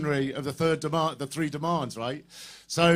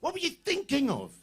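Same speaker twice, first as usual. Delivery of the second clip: mock kids; 11 semitones higher, 3 dB quieter than usual. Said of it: "the kids are just going".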